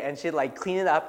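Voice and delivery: usual speaking voice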